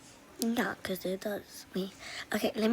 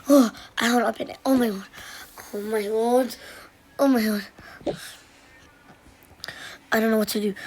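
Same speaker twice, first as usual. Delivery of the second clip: loud pretend panic; 6 dB louder, faster than usual. Pretend panic over stuck door.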